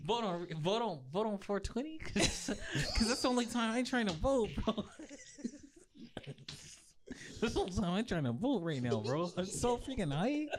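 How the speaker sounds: high, giggly stoned voice; stoner voice. Pretend. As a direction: act baked and giddy. Acting high on Gorilla Glue.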